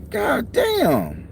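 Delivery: high stretched exclamation of disbelief; high drawn-out God damn, half-amused; mock disbelief. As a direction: react like you can't believe it. Reacting to client's rant.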